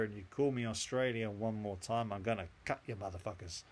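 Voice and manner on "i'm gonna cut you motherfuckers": ordinary talk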